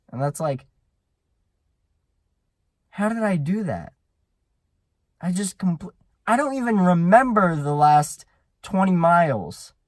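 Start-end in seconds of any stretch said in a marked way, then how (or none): 2.9-9.7 higher-pitched incredulous outburst; acted amazement. Rider looking back on the lost miles, amazed. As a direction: marvel in disbelief, building louder.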